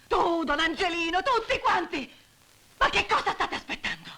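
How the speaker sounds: angry outcry. Acted. Widow berating Don Angelino and the others, furious. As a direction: lash out at everyone present.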